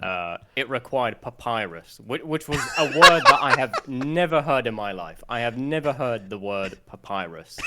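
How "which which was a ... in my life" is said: laughing. Real, not acted. Laughing at own papyrus mistake.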